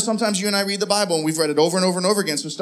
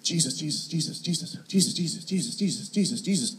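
very soft repeated muttering; imitated sleep murmur; 5 semitones lower, 11 dB quieter than usual. Wife heard praying beside him at night, imitated sleep murmur. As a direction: murmur it over and over, as if asleep. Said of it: "this is what I hear".

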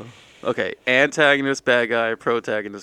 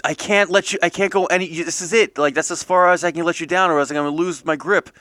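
higher and louder, straining to hold on; strained, struggling to keep his grip; a friend gasping that he can't hold on; 6 semitones higher, 5 dB louder, faster than usual. Acted. Freddy voiced holding Dan's feet.

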